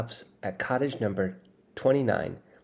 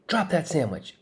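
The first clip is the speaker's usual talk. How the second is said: higher-pitched commanding bellow; 7 semitones higher than usual. Acted. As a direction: order him to drop it, full voice. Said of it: "I bellow".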